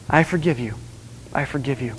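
tender reassurance. Retold or imitated. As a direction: say it gently, like a parent. God speaking tenderly.

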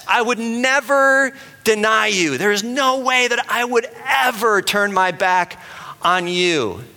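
high, slow, earnest protest from Peter; Peter's boast. Retold or imitated. Peter vows to Jesus he will never deny him, high and slow. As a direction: play Peter swearing loyalty, pitched high and drawn out. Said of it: "He says".

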